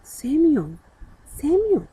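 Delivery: high, ringing call from afar; a voice calling a sleeping boy by name. A narrator's reading. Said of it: "he heard his name".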